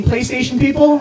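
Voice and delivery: ordinary talk, nothing marked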